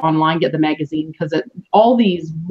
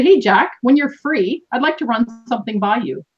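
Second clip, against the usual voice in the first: higher, welcoming invitation; warm, confident; 4 semitones higher than usual. Shown as a demonstration. Coach showing a better opener to Jack, warm and confident. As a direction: make an assured ask without being pushy. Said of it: "It's very warm and welcoming".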